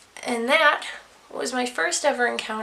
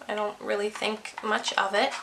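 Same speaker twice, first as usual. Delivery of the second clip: normal talking voice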